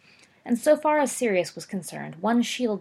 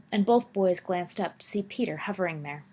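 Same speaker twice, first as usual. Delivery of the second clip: usual speaking voice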